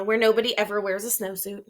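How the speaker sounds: ordinary delivery, nothing marked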